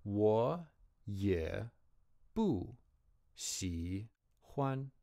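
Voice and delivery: 我 rising, 也 dipping, slowly apart; syllable by syllable with tones; slow tone demo. Shown as a demonstration. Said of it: "the 也 remains a dipping tone".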